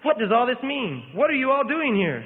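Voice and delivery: high, slow bewildered exclamation; startled awake, asking in wonder; confused astonished cry; exclaiming. A narrator's reading. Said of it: "he exclaimed".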